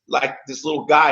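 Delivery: usual speaking voice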